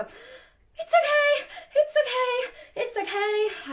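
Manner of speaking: high, loud, rapid panicky repeating; panicked reassurance. Shown as a demonstration. Owner to startled puppy, high-pitched and panicked. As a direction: panic leaking through as you try to soothe. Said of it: "can you feel the panic going through my voice as I do that rapid talking".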